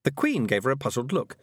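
ordinary delivery, nothing marked

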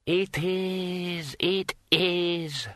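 high, thin, drawn-out spectral voice; irritable disembodied voice; snappish ghost. A narrator's reading. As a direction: cut in impatiently from beyond the grave. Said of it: "snapped Graicher's disembodied voice".

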